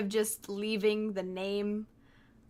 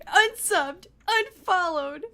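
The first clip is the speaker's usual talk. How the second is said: higher, louder, teasing huff; mock offended; 8 semitones higher, 8 dB louder, slower than usual. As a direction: pretend to be deeply hurt.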